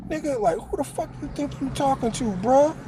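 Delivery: imitating his younger self bristling, fast; mock angry comeback. Young inmate mouthing off to Twin over the tray, high indignant challenge. Narrator snapping back at Twin.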